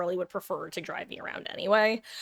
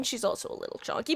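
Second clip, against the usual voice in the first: quick, hushed mock admission; conceding aside; 3 semitones higher, 6 dB quieter, faster than usual. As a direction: slip in the admission under your breath. Muttered concession in joke defense.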